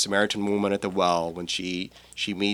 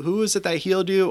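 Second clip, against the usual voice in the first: lightly voiced curious questioner, pitched higher; the villagers asking the healed man, a touch pitched up; questioning voice; 12 semitones higher, 4 dB louder, faster than usual. Priest acting out the questioners of the man born blind, mildly higher.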